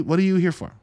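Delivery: ordinary delivery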